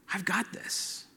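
very soft, near-whispered promise; hushed reassurance. Retold or imitated. God asks for some credit, then hushed reassurance. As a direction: say it gently, almost under the breath. God's retold reassurance closes the point.

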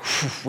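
airy puff of breath, no voice; imitated whoosh. Preacher mimicking those who bailed on Gentiles, airy whoosh. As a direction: blow a quick mock "phew".

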